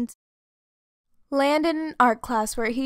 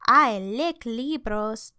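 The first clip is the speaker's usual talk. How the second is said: put-on Spanish accent; slower than usual. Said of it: "declared El Scapito Fresquito".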